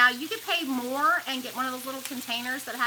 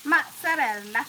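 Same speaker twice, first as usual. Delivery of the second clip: louder, hammed-up Italian mozzarella; Italian accent; 3 semitones higher, 6 dB louder than usual. Shown as a demonstration. Showing sister she'd be good with accents, Italian accent. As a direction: punch out mozzarella with an Italian flourish. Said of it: "I think I'd be good, huh, with accents".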